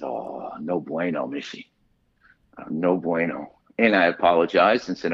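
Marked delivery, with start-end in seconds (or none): 0.0-3.5 mock dismay. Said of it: "the grown man was crying".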